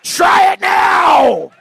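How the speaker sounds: very loud, high, drawn-out yell; challenging shout. Real, not acted. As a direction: holler it slowly as a dare. Challenging audience member over the stool.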